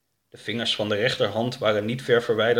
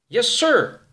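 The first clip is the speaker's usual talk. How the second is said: high, loud "yes, sir"; dutiful young sidekick; eager subordinate; 13 semitones higher, 6 dB louder than usual. Acted. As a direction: answer an order promptly, pitched up and loud. Young man takes an order.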